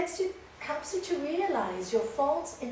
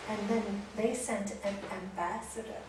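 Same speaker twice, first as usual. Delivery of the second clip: normal talking voice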